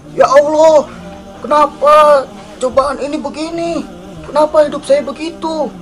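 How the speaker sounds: high, strained mock outcry in prayer; snapping at God. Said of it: "berdoa itu membentak".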